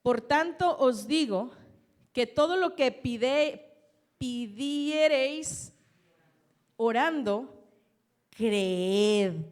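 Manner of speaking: loud, slow preaching of the verse; preaching scripture. Acted. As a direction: proclaim each word to the congregation. Preacher reading next verse.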